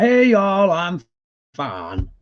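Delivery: mock Southern accent. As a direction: play a cheery Southern drawl. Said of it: "he's got the full Southern accent".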